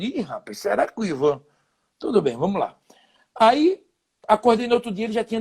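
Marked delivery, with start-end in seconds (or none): 0.0-1.4 worried thought. Retold or imitated. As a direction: think aloud, lightly uneasy.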